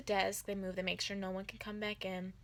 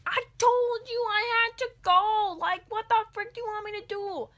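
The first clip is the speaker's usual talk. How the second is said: furious shout; 13 semitones higher, 10 dB louder than usual. Retold or imitated. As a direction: shout back that you already told her. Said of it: "I'm like".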